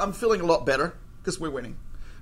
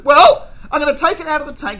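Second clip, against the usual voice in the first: angry threat; 7 semitones higher, 9 dB louder than usual. Retold or imitated. Waiter's gory threat.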